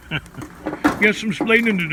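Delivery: put-on Ricky line said with Chicago accent. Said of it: "had too much of a Chicago accent to it".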